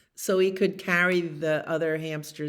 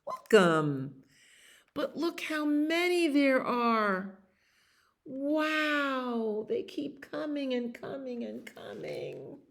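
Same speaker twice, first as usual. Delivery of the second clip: pet hamster greeting; 8 semitones higher, slower than usual. Acted. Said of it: "the little pet hamster says".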